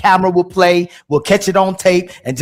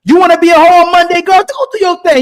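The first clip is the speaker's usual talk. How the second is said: higher, louder mock girlfriend hype; mocking women's voice; 6 semitones higher, 4 dB louder than usual. Mocking what women tell each other, sing-song. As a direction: hype her up like a cheering girlfriend. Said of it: "That's why they say".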